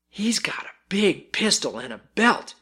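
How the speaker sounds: whispered, thrilled aside; excited whisper. A narrator's reading. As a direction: let the boy breathe it out in awe. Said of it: "exulted the leader".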